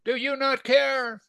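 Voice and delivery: high, drawn-out pleading complaint; aggrieved Martha. Acted. Martha complaining to Jesus about her sister, high and aggrieved.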